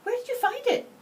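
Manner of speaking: out of breath asking; breathless. A narrator's reading. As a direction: gasp the question out breathless. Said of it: "asked breathlessly".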